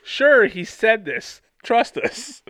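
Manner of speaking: hammed-up shifty brothers insisting it's true; sarcastic. Reading the brothers' message, mock sarcastic Trust us. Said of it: "It's like".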